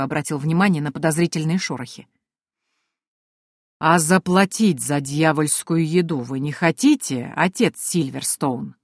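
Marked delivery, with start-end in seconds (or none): 3.8-8.7 sepulchral drawl. Acted. Penny creeping up behind the priest, slow sepulchral drawl. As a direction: drag each word out like a voice from the grave. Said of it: "За могильным голосом протянула я".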